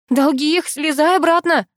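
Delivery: high, urgent plea; pleading. A narrator's reading. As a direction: beg a friend to come back down. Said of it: "взмолилась я".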